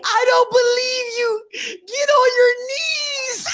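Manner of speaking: put-on high-pitched tearful kid demand; mock crying. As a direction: wail it in hammed-up distrust. Acting his crying childhood self.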